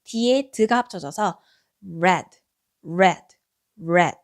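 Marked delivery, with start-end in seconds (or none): 2.0-4.2 red said lower and firm; model word. Shown as a demonstration. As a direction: repeat red clearly for students. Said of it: "빨간색이라는 뜻의 단어가". (낮고 또렷한 red)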